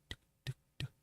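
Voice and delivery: soft, spaced "tuk" clicks; creeping footsteps. Retold or imitated. Intruder's feet approaching the bed, softly imitated.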